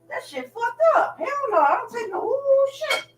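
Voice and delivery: squeaky high-pitched complaint; a high, whiny "hell no"; high squealing disgust. Acted. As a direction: squeal it out in mock horror.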